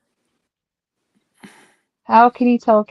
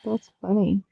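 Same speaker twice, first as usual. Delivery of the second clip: normal talking voice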